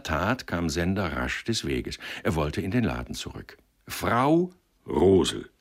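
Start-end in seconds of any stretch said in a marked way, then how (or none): 3.9-5.5 slow, loud, drawn-out address. A narrator's reading. Matchmaker addressing her within the young man's earshot, loudly. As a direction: call the name out for others to overhear. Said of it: "mit lauter Stimme".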